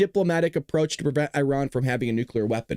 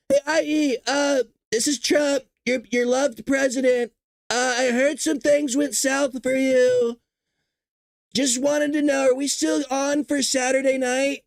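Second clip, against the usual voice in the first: higher, slow, sly mock Trump; Trump voicemail impression; 7 semitones higher, slower than usual. Trump leaving a voicemail for Iran's leader, a higher sly mock voice. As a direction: play Trump casually checking his date. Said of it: "He just go".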